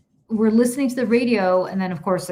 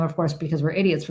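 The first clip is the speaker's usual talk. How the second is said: ordinary talk, nothing marked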